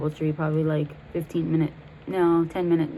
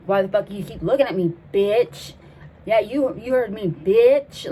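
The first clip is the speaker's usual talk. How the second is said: raised, louder confrontational retold voice; mock angry outburst; 3 semitones higher, 5 dB louder than usual. Friend snapping at people over a normal meal, mock angry. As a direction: lash out loudly as the volatile friend. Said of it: "all of a sudden she'll be like".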